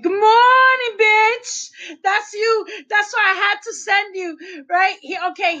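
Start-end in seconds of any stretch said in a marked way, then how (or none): 0.0-1.7 high, loud mock greeting; imitating his greeting. Wife retells the funny guy's loud greeting to her husband. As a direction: belt it out like the funny guy. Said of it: "He'd be like".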